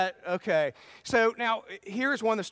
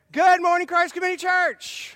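much higher and louder, beaming hello; big enthusiastic greeting; 11 semitones higher, 9 dB louder than usual. Real, not acted. Pastor greeting the congregation on a retry, excited and loud. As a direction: call out the welcome with full energy. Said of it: "So, let's try that again".

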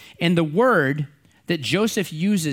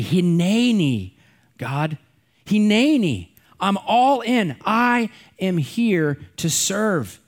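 fervent declaration; 5 semitones higher, slower than usual. Pretend. Fervent call to answer God.

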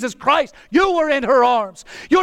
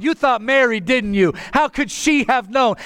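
normal talking voice

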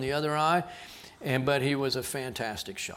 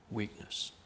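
breathy whisper of "Weakness"; hushed whisper of one word; whispered echo; 5 semitones lower, 14 dB quieter than usual. Shown as a demonstration. Preacher echoes key word.

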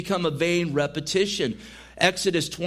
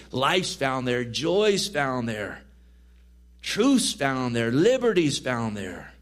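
building preaching list; 3 semitones lower, slower than usual. Real, not acted.

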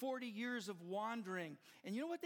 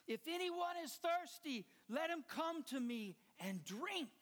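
retold scream; 8 semitones higher than usual. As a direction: cry it out across the temple crowd. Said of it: "he screamed to the top of his lungs".

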